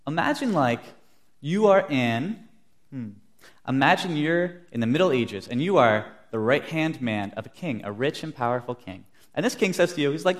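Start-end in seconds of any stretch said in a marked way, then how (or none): none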